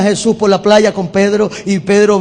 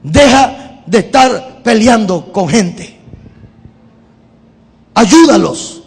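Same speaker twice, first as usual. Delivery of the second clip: fed up. Pretend. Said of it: "se pone ya hasta aquí y le dice".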